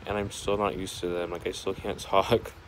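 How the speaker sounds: slight lisp from retainers. Real, not acted. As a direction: talk with retainers in. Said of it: "so that's why I have a little bit of a lisp".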